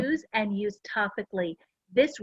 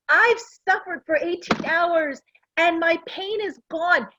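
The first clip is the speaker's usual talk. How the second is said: retelling husband's outraged outburst, pitched way up; mock angry husband; 7 semitones higher, 3 dB louder than usual. Husband bursting out that his pain is gone, mock angry and high. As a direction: act out his indignant complaint, half-laughing. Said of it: "He was so angry".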